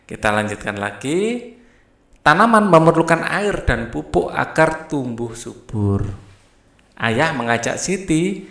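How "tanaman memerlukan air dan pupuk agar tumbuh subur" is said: ordinary talk, nothing marked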